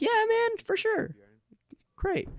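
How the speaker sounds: very high, put-on squeaky yes; exaggerated high-pitched compliance; mock agreeable. Retold forced agreement to teacher.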